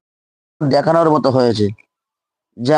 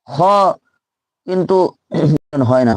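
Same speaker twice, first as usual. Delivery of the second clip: normal talking voice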